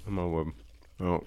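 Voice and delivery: talking while chomping a cookie; messy loud eating. Real, not acted. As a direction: mouth full, chewing into the mic. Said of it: "I'm chomping away here in the mic".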